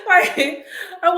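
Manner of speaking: cracking up mid-sentence; giggly punchline; laughing. Real, not acted. Laughs at own joke.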